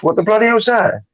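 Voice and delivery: ordinary talk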